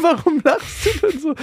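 put-on high, puzzled child's voice; imitating his daughter. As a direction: ask it like a confused little girl.

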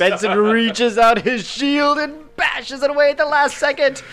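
high breathless hero telling; excited high telling. Acted. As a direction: tell it bright and quick. Excited narration of block.

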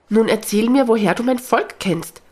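bursting to know about her people; excited. A narrator's reading. Elf eagerly asks the old dog about her people, excited. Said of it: "fragt Lisabella aufgeregt".

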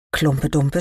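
usual speaking voice

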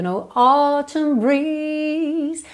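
"autumn" with a long O and clear T. Shown as a demonstration. Teacher imitates the singer's phrasing, "autumn" with lengthened O. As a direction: slowly stretch "autumn breeze", holding the vowels. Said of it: "esse O é um pouquinho mais comprido".

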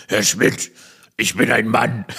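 a hint of a boy pushing his voice down to sound manly; mock manly kid voice. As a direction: proudly play the sixth-grader insisting he is a man, laughing through it. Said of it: "Mit meiner äußerst männlichen Sechstklässler-Stimme". (leicht gespielte, betont männliche Kinderstimme)

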